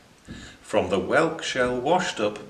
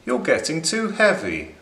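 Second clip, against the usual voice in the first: a touch of a fed-up crab, higher; grumpy crab; 6 semitones higher, faster than usual. Acted. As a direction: complain to the housemates, mildly cross. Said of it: "said Crab".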